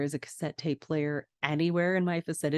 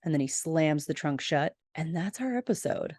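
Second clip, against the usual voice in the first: ordinary talk, nothing marked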